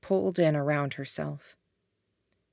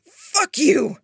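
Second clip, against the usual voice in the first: loud, high-pitched curse; blunt, blustering outburst; blustering defiance; 11 semitones higher, 7 dB louder than usual. A narrator's reading. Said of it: "blustered out a blunt".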